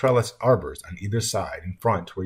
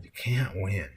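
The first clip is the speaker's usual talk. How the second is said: resigned sigh; 5 dB quieter, slower than usual. A narrator's reading. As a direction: let it sink into a mild sigh. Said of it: "let out a combination sigh and yodel".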